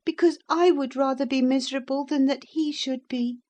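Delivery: put-on high-pitched girlish pleading; earnest young lady. Young lady assuring the narrator of her love, earnest. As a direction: protest devotion in a high voice.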